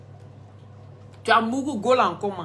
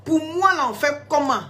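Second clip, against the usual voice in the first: raised, insistent challenge; loud demand; 5 semitones higher, 4 dB louder than usual. Real, not acted. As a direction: call him out, much louder and higher. Confronting the general on live.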